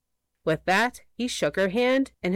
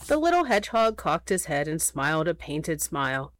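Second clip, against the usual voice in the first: usual speaking voice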